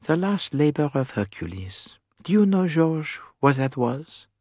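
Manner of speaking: lightly murmured Poirot musing; mild murmur. A narrator's reading. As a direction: muse aloud with a hint of a murmur. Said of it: "Poirot murmured".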